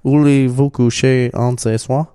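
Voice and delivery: playful fake French accent; lightly put-on French, rising; foreign accent in French. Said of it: "weren't we just trying to speak French".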